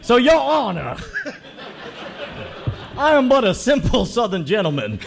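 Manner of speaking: put-on Southern gentleman accent, humble plea; Southern lawyer voice. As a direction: address the judge with a Southern accent. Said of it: "I was not aware I was doing a Southern Lawyer voice".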